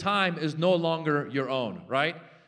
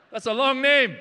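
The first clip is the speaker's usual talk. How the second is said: exasperated shout; 8 semitones higher, 8 dB louder than usual. Acted. Comic rant about volcano.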